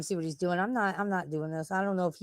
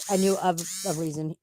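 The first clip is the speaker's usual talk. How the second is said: ordinary talk, nothing marked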